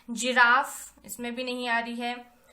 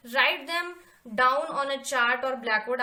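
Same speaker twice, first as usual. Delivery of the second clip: slow dictation; 5 dB louder, much slower than usual. Shown as a demonstration. Reading task aloud to class.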